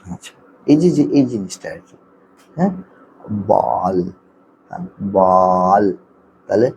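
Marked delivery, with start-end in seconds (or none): 3.5-4.0 বল with the vowel stretched; drawn-out blend. Shown as a demonstration. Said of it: "sound কিন্তু তিনটা". 5.2-5.8 বল stretched long and steady in pitch; drawn-out blend. Shown as a demonstration. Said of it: "sound কিন্তু তিনটা".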